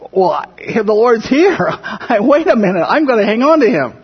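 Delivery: grab on and don't let go; eager Mary. Retold or imitated. Mary refusing to let go of the Lord, excited and laughing. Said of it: "this is what I think Mary".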